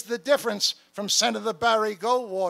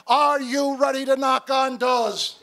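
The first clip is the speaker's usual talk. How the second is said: louder call, challenging the crowd to mean it; rallying shout; 4 semitones higher, 7 dB louder than usual. Real, not acted. Speaker doubts the crowd's answer and asks again, louder shout. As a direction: shout the question again, demanding more.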